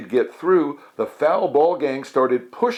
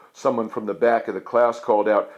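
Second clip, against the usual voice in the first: ordinary delivery, nothing marked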